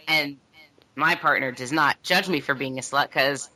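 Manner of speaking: normal talking voice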